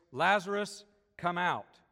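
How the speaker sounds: calling loudly into the tomb; loud shout. A narrator's reading. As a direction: shout it out with full voice. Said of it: "Jesus shouted with a loud voice".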